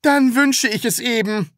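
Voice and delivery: high, hurried concession; hasty. A narrator's reading. Taschenbier giving in to the Sams, hasty. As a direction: blurt it out to save the tie. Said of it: "sagte Herr Taschenbier schnell".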